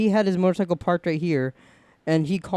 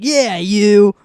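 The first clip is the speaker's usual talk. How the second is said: insisting call; 4 semitones higher, 9 dB louder than usual. Retold or imitated. Biker insisting.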